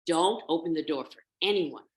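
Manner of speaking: emphatic motherly instruction; mother goat's warning. Acted. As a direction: warn the little ones firmly. Mother warns over her shoulder.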